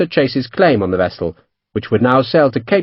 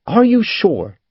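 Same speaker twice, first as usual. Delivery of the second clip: higher, earnest question; serious; 7 semitones higher, slower than usual. A narrator's reading. Captain checking Sharp's identification, serious. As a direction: ask it in all seriousness. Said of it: "Chase said seriously".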